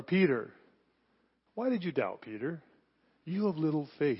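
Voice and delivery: soft, tender questioning; gentle rebuke. Shown as a demonstration. Jesus in the water gently asking Peter, soft and tender. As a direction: ask him gently, like a caring friend.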